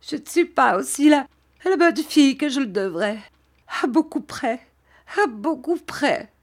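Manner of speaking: high, tearful self-reproach; acted weeping, pitched well up; sobbing. A narrator's reading. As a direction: blame yourself in a high sobbing voice. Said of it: "en sanglotant et en secouant la tête".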